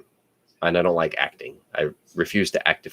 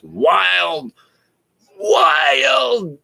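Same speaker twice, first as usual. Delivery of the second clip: excited shout. Real, not acted. Excited about wild relatives.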